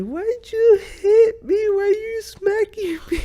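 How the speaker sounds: imitating his girlfriend's crying, very high and small; a whimpering, tearful squeak as if struck; hurt, heartbroken. Girlfriend hit in her sleep, asking him through tears. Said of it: "You could hear it in the tone of her voice".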